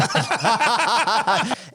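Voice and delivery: bright burst of high laughter. Real, not acted. Laughs at own joke.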